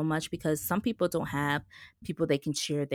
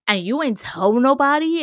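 indignant; 8 semitones higher, 11 dB louder than usual. Retold or imitated. Uncle kept it secret.

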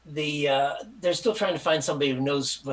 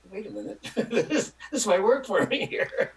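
pleased realization; 8 semitones higher than usual. Retold or imitated. Acts his own happy realization.

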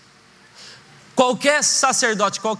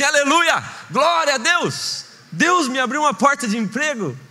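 joyful boast; 5 semitones higher than usual. Acted. Happy brother boasting new job.

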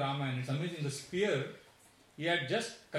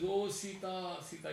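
imitated wailing; 4 semitones higher than usual. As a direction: lament lightly, drawing out the name. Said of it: "uh, wailing".

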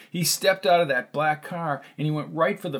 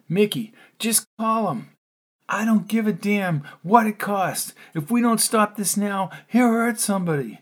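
lightly voiced Ben; 7 semitones higher than usual. A narrator's reading. Ben urging Mickey on the phone, a touch of urgency. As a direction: press the friend on the phone to act now.

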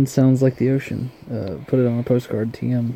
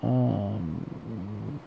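soft sleepy narration; 6 dB quieter than usual. Acted. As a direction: murmur it like a bedtime story. Sleepy time thoughts host voice.

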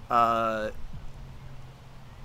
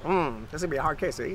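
forties detective; 4 dB quieter, faster than usual. Acted. Joining the co-host's detective bit.

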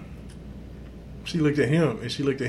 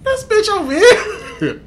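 amazed; 6 dB louder, slower than usual. Retold or imitated. Retold amazed reaction to the woman.

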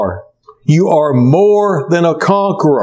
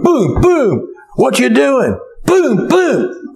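mock punch sounds; 10 semitones higher, slower than usual. Imitating Rocky's fight punches.

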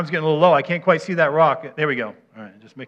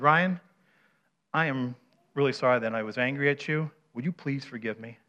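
softer, lower demonstrated apology; sincere apology; 3 semitones lower, 6 dB quieter than usual. Shown as a demonstration. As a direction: ask forgiveness earnestly, letting the voice drop. Models a sincere apology to Ryan.

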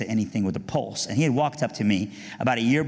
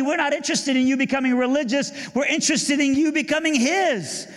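much higher, earnest youth-group voice; eager teen voice; 14 semitones higher than usual. Retold or imitated. Youth group pleading with David at his house, eager and high. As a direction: play the keen church kid pleading.

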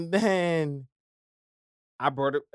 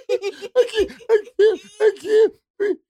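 much higher, loud fake crying gasp; hammed-up sobbing; 8 dB louder than usual. Kid faking an asthma attack in the car, hammed-up sobbing. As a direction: fake a sobbing breathless fit. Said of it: "you having an attack".

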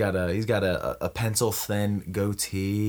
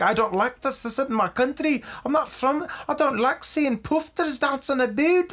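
high-pitched, grumbling Scotsman act; indignant Scotsman; 12 semitones higher than usual. Acted. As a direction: play an affronted Scotsman, pitched way up. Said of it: "The Scotsman's like".

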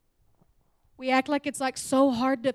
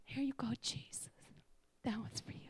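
tiny, polite whisper, gently placing a crown; mock timid whisper; 16 dB quieter than usual. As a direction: hand it over in a meek whisper. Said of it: "like, placing them".

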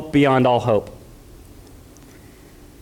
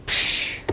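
soft, airy "psh"; dismissive psh; 6 dB quieter than usual. Acted. Preacher shrugging off the arrows, dismissive psh. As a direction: brush it off with a hushed puff.

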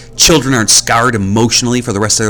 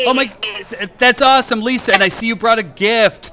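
high, delighted welcome at the door; excited greeting. Acted. Host greeting Lisa at the door with high delight. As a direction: gush over a guest's arrival.

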